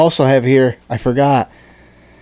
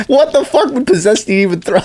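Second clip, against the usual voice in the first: ordinary talk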